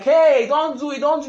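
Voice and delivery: retold crowd protest. Crowd warning a speaker off, mock calling out. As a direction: call out a mild warning.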